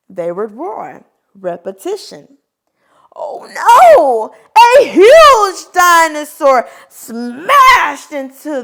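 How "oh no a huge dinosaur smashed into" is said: loud, high cry of alarm; hammed-up oh no shout; mock alarm shout. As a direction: shout it like the story's panic. Huge dinosaur arrives.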